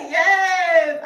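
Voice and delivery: loud, high burst of joy; excited shout. Real, not acted. Hyped by the song, she shouts yes. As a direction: cheer it out.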